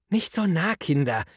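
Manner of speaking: high-pitched mild scolding. Acted. Kindergarten teacher to children crowding round, scolding. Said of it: "tadelte Frau Bäuerlein die Kleinen".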